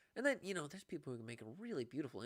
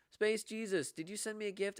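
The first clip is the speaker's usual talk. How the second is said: high, loud call of a viewer's name; excited shout-out; 10 semitones higher, 5 dB louder than usual. Real, not acted. Streamer calling out to a generous viewer, excited. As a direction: call out to the gifter with a jolt of delight.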